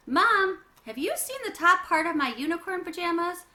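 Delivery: loud high call from another room. Acted. Girl calling to her mom from her bedroom, loud call.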